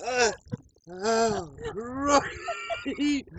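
high gasping from the icy cold; in ice cold water. Real, not acted. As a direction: gasp through ice cold shock. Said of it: "a lot colder than I thought".

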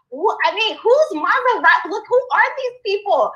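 high, amazed disbelief; incredulous. Retold or imitated. Neighbor spotting a Bentley nearby, high incredulous amazement. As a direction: gawk at a passing luxury car in disbelief. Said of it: "I'm just like".

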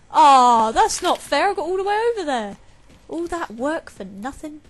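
high, loud whining rage; raging complaint. Real, not acted. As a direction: vent the unfairness at full volume. Raging after losing progress.

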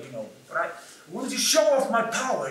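ordinary talk, nothing marked

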